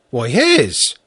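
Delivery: ordinary delivery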